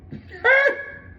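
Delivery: sudden loud high laugh; burst of high laughter. Real, not acted. Laughing loudly at the coworker's rude reply. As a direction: crack up at the memory.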